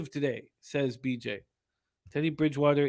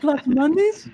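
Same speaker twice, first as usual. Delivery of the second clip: high-pitched mock catchphrase; higher voice; 11 semitones higher, 4 dB louder, slower than usual. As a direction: put on a much higher voice, imitating a friend's pet phrase. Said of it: "say it with a bit of a higher, uh, voice".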